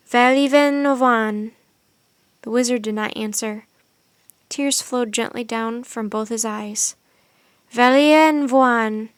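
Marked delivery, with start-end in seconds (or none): 0.1-1.5 strange language. A narrator's reading. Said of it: "in a strange language". 7.7-9.1 strange language. A narrator's reading. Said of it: "the Swan Maiden said again".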